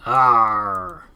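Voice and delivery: loud pirate arr; pirate growl. Acted. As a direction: bellow it like a pirate. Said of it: "talk like a pirate day".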